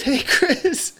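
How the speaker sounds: put-on high child's greeting through giggles; high-pitched kid voice; little kid. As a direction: imitate a small boy saying hi. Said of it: "he goes".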